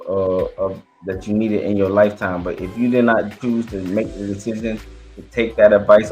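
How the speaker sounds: usual speaking voice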